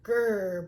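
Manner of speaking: a light coyote growl; mock growl. Coyote growling at the plump lamb. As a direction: growl at her again. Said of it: "He growled".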